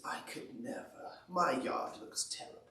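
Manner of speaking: soft, breathy, dispirited Toad, mostly whispered; gloomy Toad. Acted. Toad complaining to Frog about his yard, soft and glum. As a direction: mumble it like nothing will ever be good enough. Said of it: "he's never quite satisfied".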